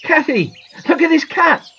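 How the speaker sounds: high, excited call. A narrator's reading. Boy calling his missing sister to look, calling out. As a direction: call out to someone out of sight. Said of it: "called John, looking for his sister".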